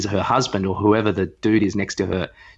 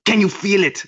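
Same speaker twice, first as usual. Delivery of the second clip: high, pushy mock-spiritual voice; a bit aggressive; 8 semitones higher than usual. Imitating the presenter urging the crowd, high and a bit aggressive. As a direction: push the lines at the crowd. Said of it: "She goes".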